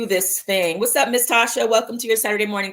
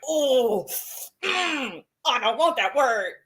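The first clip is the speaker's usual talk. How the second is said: mock cringe; 4 semitones higher than usual. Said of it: "like, cringe".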